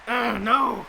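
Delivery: high, loud excited wrestling commentary; live play-by-play. Acted. Commentator calling the match for the viewers, excited play-by-play. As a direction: call every move like a ringside announcer.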